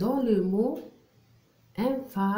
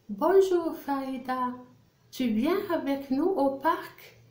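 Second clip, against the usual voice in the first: raised, lilting dialogue voice; Mustapha in read dialogue; 5 semitones higher than usual. Acted. Teacher voicing a boy inviting Farida to the park, high read-aloud voice. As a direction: read the invitation out in a higher pitch. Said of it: "Je lis le dialogue".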